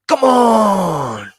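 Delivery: put-on high, loud excited shout of "Come on!" in an Argentine accent; Fernando's catchphrase. Guide imitating Fernando cheering the group on, high loud shout. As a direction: belt it out like a cheering navy commander. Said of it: "exactly verbatim accent and everything".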